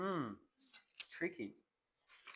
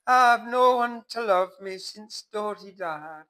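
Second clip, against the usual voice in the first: higher, hammed-up bitter lament; a touch of mock bitter weeping; bitterly crying; 9 semitones higher, 14 dB louder than usual. Kitty lamenting to the narrator, mock bitter cry. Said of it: "she bitterly cried".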